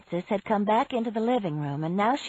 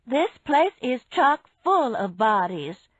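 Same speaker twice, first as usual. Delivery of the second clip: louder, slower call from another room; calling loudly from the kitchen; 5 semitones higher, 5 dB louder, slower than usual. Acted. Woman calling to friends from the kitchen, louder. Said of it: "she called from the kitchen".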